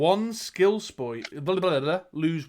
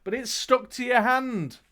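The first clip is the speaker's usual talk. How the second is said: dramatic storyteller, key words punched; game master narrating the action; gamebook narration; 4 semitones higher, 4 dB louder than usual. A narrator's reading. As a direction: read it out like an adventure tale. Reads gamebook aloud to player.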